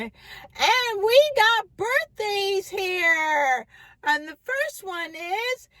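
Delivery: high, slow sing-song MC announcing a birthday; announcer voice. Retold or imitated. Bar announcer calling out the birthday girl, high and slow. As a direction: drag it out like a hype man. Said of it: "they made an announcement".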